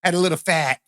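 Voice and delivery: hammed-up Boston accent. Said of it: "I can't do the Boston accent".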